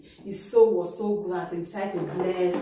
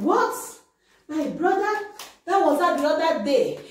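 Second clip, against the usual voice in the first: shocked outburst; 7 semitones higher than usual. Acted. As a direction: react in shock to the news. Esau learns of deception.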